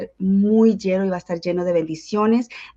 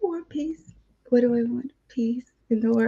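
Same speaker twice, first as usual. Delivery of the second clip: higher, sweet pageant-speech voice; pageant contestant; 4 semitones higher than usual. Pretend. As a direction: give a mock pageant answer. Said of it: "like if I was in a pageant".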